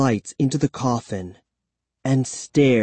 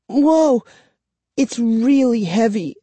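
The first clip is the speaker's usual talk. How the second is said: high, strained under weight; straining groan; 11 semitones higher, 3 dB louder than usual. A narrator's reading. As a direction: groan while lifting. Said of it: "she groaned".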